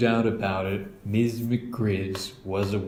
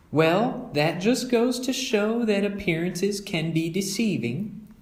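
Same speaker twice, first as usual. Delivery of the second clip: higher, gentle mother voice; a kindly mother making a point; Mama; 10 semitones higher than usual. Acted. Mother teaching her cubs a saying, gently.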